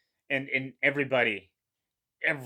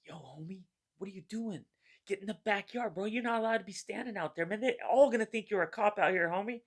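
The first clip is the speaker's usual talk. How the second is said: higher-pitched street homie voice; gangbanger voice; 8 semitones higher, 3 dB quieter than usual. Retold or imitated. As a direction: imitate a San Diego homie calling you in. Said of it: "it was like this".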